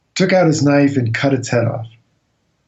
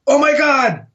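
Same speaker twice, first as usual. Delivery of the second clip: shocked; 12 semitones higher than usual. Retold or imitated. Crew's horrified reaction.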